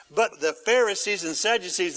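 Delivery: ordinary talk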